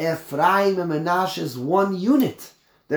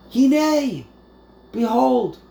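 Hinei lifted high and loud, drawn out; dramatic Hinei; 8 semitones higher, 6 dB louder, slower than usual. Shown as a demonstration. Teacher to class, dramatic proclaim of Hinei. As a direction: ring out Hinei like a sudden surprise.

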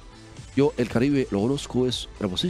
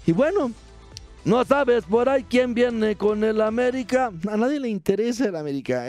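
raised, hammed-up sidekick voice; high comic character voice; 9 semitones higher than usual. (voz de personaje cómico aguda)

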